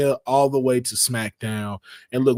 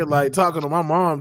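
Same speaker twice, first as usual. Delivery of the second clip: ordinary delivery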